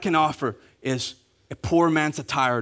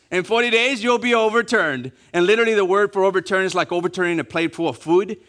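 ordinary talk

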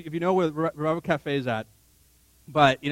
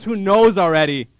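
usual speaking voice

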